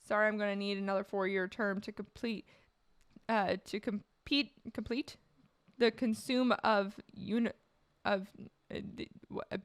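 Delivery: halting, level first attempt; stumbling read. Real, not acted. Streamer botches the pledge excuse, stumbling. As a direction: read it haltingly, tripping over the words. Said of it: "let me try that again".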